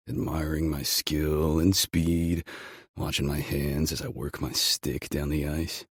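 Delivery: ordinary talk, nothing marked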